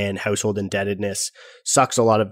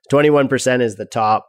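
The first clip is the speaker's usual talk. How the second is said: ordinary talk, nothing marked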